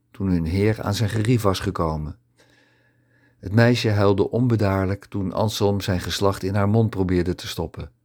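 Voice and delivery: ordinary delivery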